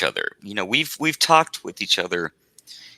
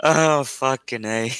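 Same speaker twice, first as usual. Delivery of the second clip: slow, tipsy exclamation; 2 semitones higher, 3 dB quieter, slower than usual. Real, not acted. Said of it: "I'm a little bit tipsy".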